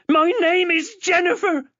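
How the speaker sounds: high, loud furious yell; furious insistence. Acted. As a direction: scream your own name back. Chloe insists on her name.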